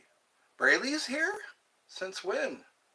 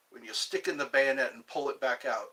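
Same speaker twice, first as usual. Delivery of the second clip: ordinary talk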